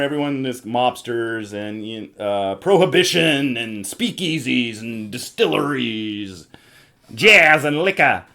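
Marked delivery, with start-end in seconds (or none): 2.6-8.2 loud, drawn-out theme pitch, higher voice; hammed-up showman. Host pitching the bootlegging game's theme, hammed-up. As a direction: sell each word big, slow and high.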